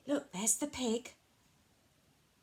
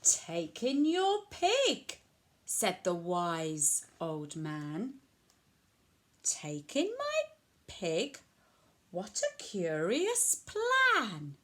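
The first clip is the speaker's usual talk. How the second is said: lilting picture-book storytelling with sweeping pitch; reading to children; 3 semitones lower, slower than usual. A narrator's reading. Old man advises puzzled old lady, animated storytelling. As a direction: read it out clearly for a small child.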